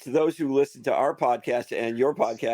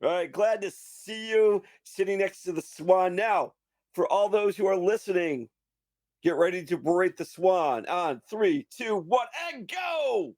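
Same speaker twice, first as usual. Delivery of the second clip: higher and building to a shouted go; rally the listeners to the final go; hype host countdown; 7 semitones higher than usual. Acted.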